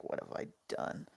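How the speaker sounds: very low, hushed mock horror; horrified regret. Kid realizing the mouthful is honey, hushed mock horror. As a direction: drop to a near-whisper of regret.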